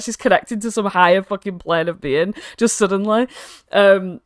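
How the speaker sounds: normal talking voice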